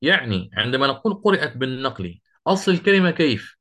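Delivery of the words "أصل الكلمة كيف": ordinary talk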